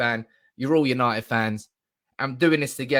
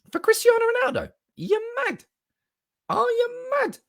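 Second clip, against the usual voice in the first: very high squeaky voice; squeaky mock outrage. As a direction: play a shocked little voice. Mock outrage at cheap fee.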